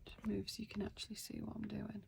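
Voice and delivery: whispered aside while shifting the camera; quiet whisper to the viewer. Real, not acted. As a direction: breathe it out, barely voiced. Adjusting camera, muttered aside.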